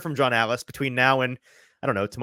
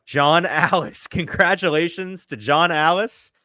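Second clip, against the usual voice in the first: slow, raised congratulatory call; celebratory announcement; 5 semitones higher, 3 dB louder, slower than usual. Real, not acted. Host congratulating the giveaway winner, drawn-out celebratory announcer voice. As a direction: echo the winner's name, stretched and high.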